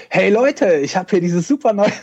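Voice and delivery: laut und hoch wie ein Produktanpreiser; übertrieben enthusiastischer Promo-Ton; Werbeansager. Pretend. Parodiert Promo im Frühstücksfernsehen. (enthusiastischer Verkaufston)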